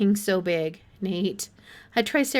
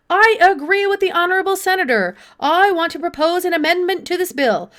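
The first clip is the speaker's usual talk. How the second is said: higher, louder toadying senator voice; eager fawning senator; 5 semitones higher, 6 dB louder than usual. A narrator's reading. A fellow senator rises to back Granderson, eager and flattering. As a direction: flatter the colleague with loud enthusiasm.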